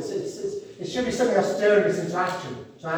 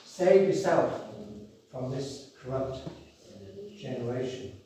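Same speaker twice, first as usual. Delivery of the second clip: hushed solemn warning; 4 semitones lower, 6 dB quieter than usual. Shown as a demonstration. Preacher repeating Peter's warning to the congregation, hushed. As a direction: let the warning sink in quietly. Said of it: "The word doesn't change".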